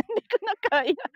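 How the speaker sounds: high nervous laugh. Real, not acted. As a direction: laugh through the fear. Scared laugh on glass walk.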